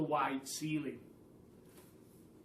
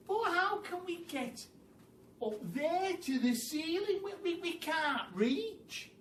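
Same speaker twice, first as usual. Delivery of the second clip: high, fretful voices, stammering; worried little monkeys; 12 semitones higher than usual. Acted. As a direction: fret that they can't reach. Small monkeys fret about reaching.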